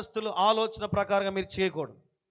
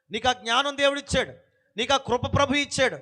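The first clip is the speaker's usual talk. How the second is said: louder closing appeal; forceful preaching; 5 dB louder than usual. Acted. Preacher calling listeners to give up sin, forceful preaching. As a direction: drive the promise home to the church.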